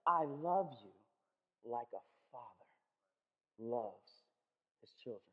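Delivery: low, hushed, slow fatherly tenderness; softly slowed, deep tender promise. Shown as a demonstration. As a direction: say it gently, like a father to his child. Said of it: "He says".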